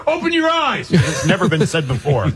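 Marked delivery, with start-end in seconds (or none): 0.1-0.9 high-pitched imitation of a ballpark heckle; mock heckler yell. Host mimics a fan heckling the umpire, mock yell. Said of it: "I'm gonna tell this umpire".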